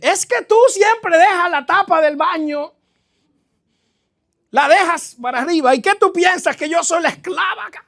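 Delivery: hammed-up blaming of the spouse; mock nagging wife. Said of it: "Cambiar el "es que tú".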